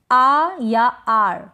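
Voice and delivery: "आ या आर": पहले लंबा आ, फिर हल्के र के साथ आर; धीरे, अलग-अलग बोलकर "आ या आर" सिखाएँ; AR के दो उच्चारण. Shown as a demonstration. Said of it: "याद रखें, एआर को आप". ("आ या आर" को खींचकर, र हल्का रखते हुए)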